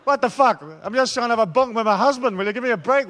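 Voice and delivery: loud, high comic outburst; exasperated protest. Acted. Lover interrupted by religious rules, loudly exasperated.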